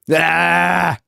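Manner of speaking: sudden loud, high "ah" scream; hammed-up shouting outburst; play-acted yell. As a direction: blast out a short mock scream. Play yelling match with echo.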